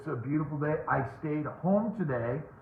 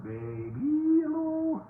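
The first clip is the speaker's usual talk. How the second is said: playful squeaky greeting; 13 semitones higher, much slower than usual. Real, not acted. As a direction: greet them in a silly, stretched-out high voice. Playful greeting to viewer Doc.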